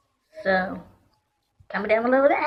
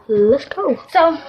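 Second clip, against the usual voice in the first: ordinary talk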